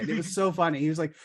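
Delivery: ordinary delivery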